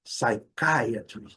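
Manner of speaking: very slow "psychiatry" as sai-KAI-a-tri; pronúncia corrigida. Shown as a demonstration. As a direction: stretch out "psychiatry" with a strong KAI. ("psychiatry" bem devagar, com "CAI" forte)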